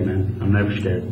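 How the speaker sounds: normal talking voice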